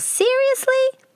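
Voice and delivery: very high, slow squeal. Acted. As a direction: squeal it in disbelief. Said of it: "Rayhan squeals".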